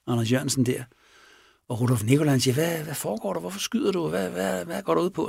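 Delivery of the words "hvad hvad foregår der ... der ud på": higher, softer voice of retold alarm; bewildered questioning. Nikolaj questioning the man who shot, bewildered. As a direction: stammer out anxious questions, pitched up.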